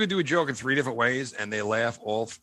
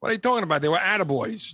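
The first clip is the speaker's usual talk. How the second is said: higher, louder mock denial; playing Cuomo; 7 semitones higher, 4 dB louder, faster than usual. Pretending to be Cuomo defending himself, higher louder mock denial. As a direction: scoff it off as the accused governor. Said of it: "That's what I would say".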